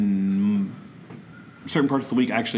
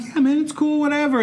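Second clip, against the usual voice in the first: mock casual. Faking nonchalance.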